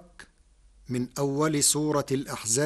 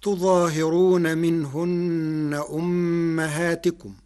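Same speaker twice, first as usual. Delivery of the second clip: slow, chanted tarteel; chanted Quranic verse; Quran recitation; 2 semitones higher, 3 dB louder than usual. Shown as a demonstration. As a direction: recite the verse slowly and melodically. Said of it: "قرأ ورش كلمة تظاهرون".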